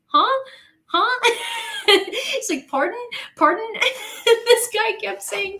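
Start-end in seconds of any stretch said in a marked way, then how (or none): 0.1-1.2 high, loud questioning grunts; baffled English dude. Retold or imitated. Sandwich man not understanding a tourist, baffled and high-pitched. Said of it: "he was just like". 2.7-3.7 high, rising puzzled queries; baffled English dude. Retold or imitated. Sandwich man not understanding the tourist, baffled and high. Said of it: "this poor English dude".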